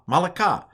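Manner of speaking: "Malaka" said carefully as ma-la-KA, falling at the end; corrected pronunciation. Shown as a demonstration. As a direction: give "Malaka" its three clear syllables.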